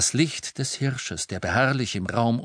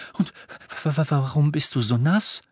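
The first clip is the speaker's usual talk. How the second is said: puzzled stutter; stammering; 6 semitones higher, 3 dB louder than usual. Acted. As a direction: trip over the question. Stammering surprise.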